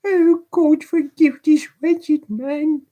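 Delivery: put-on very high, slow child's voice near tears; a boy pleading for mercy; boy crying out. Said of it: "cried the boy with a burst of tears".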